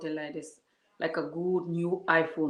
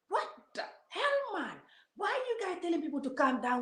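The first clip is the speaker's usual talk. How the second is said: outraged disbelief; 10 semitones higher, 4 dB quieter than usual. Real, not acted. Outraged at commenters' advice.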